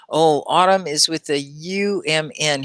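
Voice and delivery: ordinary talk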